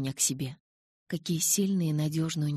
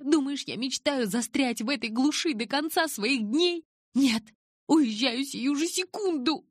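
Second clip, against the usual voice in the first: shouting; 11 semitones higher, 4 dB louder than usual. A narrator's reading. Bella yells cruel lines at Charlie.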